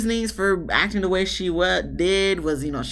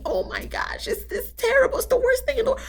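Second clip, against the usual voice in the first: mock dramatic wail. Mocking Alyssa's dramatics.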